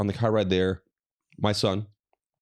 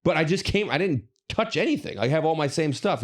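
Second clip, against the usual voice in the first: higher, pleading disbelief at having to redo it; exasperated protest; 6 semitones higher than usual. Retold or imitated. Retelling arguing with the TSA lady, exasperated and higher. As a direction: protest it's pointless, voice climbing.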